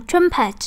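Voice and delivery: TRUM-pet, beat on the first syllable; stress on first syllable. Shown as a demonstration. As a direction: say "trumpet" slowly, stressing TRUM. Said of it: "âm một đúng không". (nhấn âm đầu của "trumpet")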